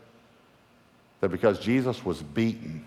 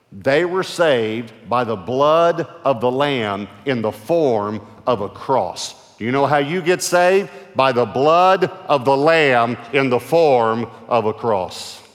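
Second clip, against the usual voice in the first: loud, rising revival preaching; fiery preacher's call; preaching at full force; 6 semitones higher, 7 dB louder than usual. Acted. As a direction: preach it out to the crowd, building.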